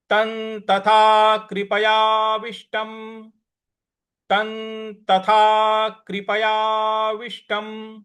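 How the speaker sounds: तं with n sound, slow chanted; anusvara as n. Shown as a demonstration. Teacher recites the first shloka slowly. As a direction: say तं as tan before त. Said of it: "उसका उच्चारण हमें न ले आना है".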